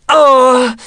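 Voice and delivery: high yelled "oh"; sudden high cry, moderately loud. A narrator's reading. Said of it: "urlò". (urlo acuto)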